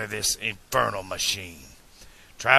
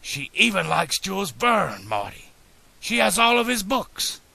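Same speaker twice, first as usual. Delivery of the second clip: higher-pitched, gushing admiration; excited gushing; 9 semitones higher than usual. A narrator's reading. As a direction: gush about the woman you love. Smitten inventor gushes about his love.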